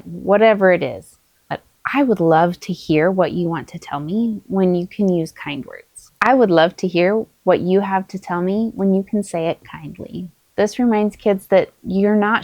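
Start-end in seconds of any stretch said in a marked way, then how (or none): none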